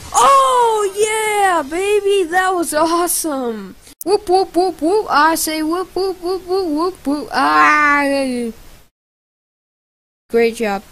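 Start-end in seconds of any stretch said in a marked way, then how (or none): 0.1-3.8 high, loud whoop easing down; big high-pitched celebration that settles as it ends; thrilled cheer. Real, not acted. 4.0-7.3 string of high, bouncy whoops; high-pitched repeated victory whoops; celebratory whooping. Real, not acted. 7.3-8.5 long, loud "ah" cry; drawn-out loud holler; loud scream. Real, not acted. Cry out during play.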